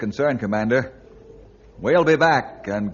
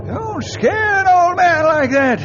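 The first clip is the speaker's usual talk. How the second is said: relieved scolding; 8 semitones higher, 4 dB louder, slower than usual. Acted. After the man nearly fell.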